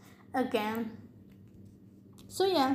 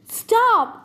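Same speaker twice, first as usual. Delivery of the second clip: sharp higher, louder yell; 9 semitones higher, 6 dB louder than usual. A narrator's reading. Percy yells at the giant to stop, yelled. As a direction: shout it out to halt an attack. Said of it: "I yelled".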